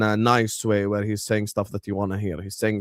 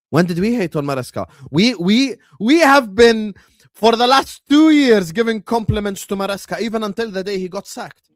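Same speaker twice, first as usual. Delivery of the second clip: shouting, much higher and louder, furious; angry outburst; 10 semitones higher, 7 dB louder than usual. Real, not acted. Defending himself to his co-host, shouting angrily. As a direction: blast back at the accusation at full volume. Angry rebuttal of hating accusation.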